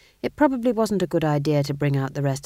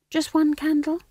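very high, small plea; pleading; 13 semitones higher, 4 dB louder than usual. A narrator's reading. Young witch begs for one candle, pleadingly. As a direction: beg for it with the voice right up high. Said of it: "a look of pleading".

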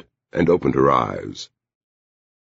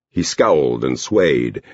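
ordinary delivery

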